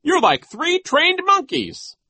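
very high, slow, loud character voice; mocking Nero. A narrator's reading. Nero compares children to monkeys, high mockery. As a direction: jeer it high and drawn out.

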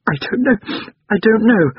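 put-on high-pitched child in tears; tearful little boy. A narrator's reading. Boy in tears answering his mother, tearful. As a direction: act a small boy crying, pitched high. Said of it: "The little boy was in tears".

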